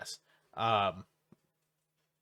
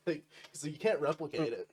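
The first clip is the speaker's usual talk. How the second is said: laughing; 6 semitones higher than usual. Real, not acted. Laughs at own joke.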